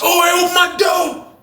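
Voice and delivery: very high holler; imitated high-pitched shout; calling the guard. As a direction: yell down the tier to the officer. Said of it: "he said".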